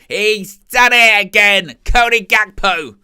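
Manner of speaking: slow, drawn-out booming commentator call; Lee Jones commentator voice. Retold or imitated. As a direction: stretch the goal call like a TV commentator. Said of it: "I sound like Lee Jones".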